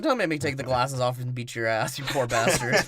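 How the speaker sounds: a hint of a tough old lady; mock gruff wife. Wife voiced, threatening.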